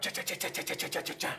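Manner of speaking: quick whispered cha chopping noises; chopping sound effect. Acted. Scissors chops at Rock, whispered chopping noises. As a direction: make the snipping sound of blades.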